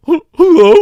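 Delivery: loud, tearful mock phone hello; imitated crying. Dad coming to the phone in tears, imitated crying. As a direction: choke out a hammed-up weepy hello. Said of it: "Are you crying?".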